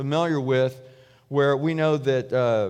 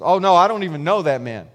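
louder, emphatic mock protest; acted denial; 7 dB louder, faster than usual. Peter denies knowing Jesus to his accusers, acted louder protest. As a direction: deny it hard, as if accused.

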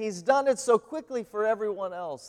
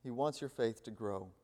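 soft echo; 9 semitones lower, 14 dB quieter than usual. Shown as a demonstration. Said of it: "repeated line for emphasis".

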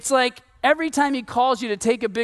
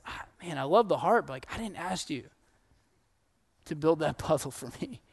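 softer, lower and kind; gentle correction; 5 semitones lower, 8 dB quieter than usual. Retold or imitated. God kindly telling the believer he never asked, softly and tenderly. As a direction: let him down tenderly, almost smiling.

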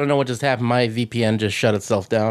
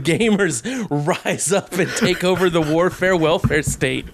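laughing; 3 semitones higher than usual. Real, not acted. Joking about gamer uprising.

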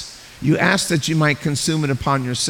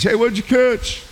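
friendly call from the beach; 7 semitones higher than usual. Retold or imitated. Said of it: "some guy on the beach".

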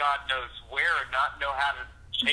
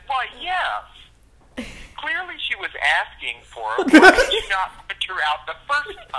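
sarcastic brother; 7 semitones higher, 8 dB louder than usual. Retold or imitated. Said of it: "And he said".

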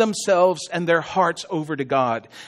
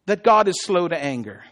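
ordinary talk, nothing marked

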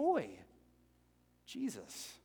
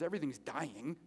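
normal talking voice

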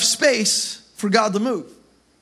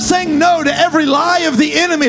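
preaching at full force; 3 semitones higher than usual. Acted. Pastor rallying the church to say no, full-force preaching. Preaching climax, shouting commitment.

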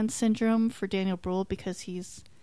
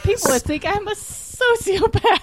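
mock British accent; 13 semitones higher, 8 dB louder than usual. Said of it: "do all the press with a British accent".

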